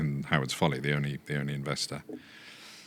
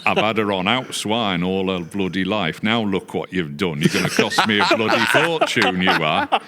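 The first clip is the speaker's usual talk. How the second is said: put-on loud, higher Yorkshire old boy; hammed-up Yorkshire husband grumbling; Yorkshire accent; 5 semitones higher, 10 dB louder than usual. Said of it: "the old boy".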